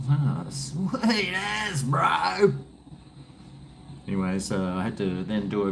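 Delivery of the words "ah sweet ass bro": put-on slow drawled broad kiwi accent. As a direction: say it like a laid-back broad kiwi bloke. Own reply in put-on accent.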